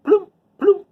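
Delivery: high, loud playful plump plump; raindrop sound. A narrator's reading. Teacher voicing raindrops hitting dust for kids, playful high sound. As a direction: pop out the raindrops like little splashes.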